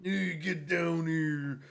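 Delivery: very slow, drawn-out challenge; slow wrestler callout. Acted. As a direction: taunt the opponent slowly. Wrestling trash talk.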